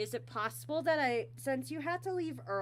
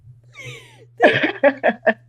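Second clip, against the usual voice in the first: loud, very high real laugh; burst of laughter; 17 dB louder than usual. Real, not acted. Host hearing friend choked on chowder, loud laugh.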